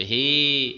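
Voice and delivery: হে with the এ drawn out; prolonged e. Shown as a demonstration. Teacher isolating the prolonged vowel in হে. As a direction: hold the এ at the end of হে. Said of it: "এই যে এ শেষে এলো".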